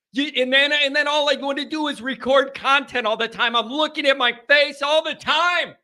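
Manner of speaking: high-pitched comic griping; mock exasperation. Host griping to viewers about his own face, mock exasperated.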